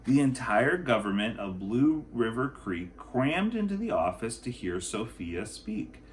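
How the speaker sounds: usual speaking voice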